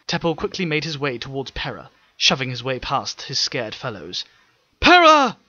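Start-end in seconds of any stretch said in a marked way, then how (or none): 4.8-5.4 much higher, louder frightened call; fearful shout. Acted. Teppel calling Pera through the chaos, fearful shout. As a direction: call her name in panic. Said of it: "his voice rich with fear".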